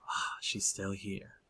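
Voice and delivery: breathy, sighed whisper; whispering to himself. Acted. Werewolf sniffing the air and talking to himself, whispering. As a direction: murmur it under the breath, unvoiced and very soft. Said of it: "he says to himself".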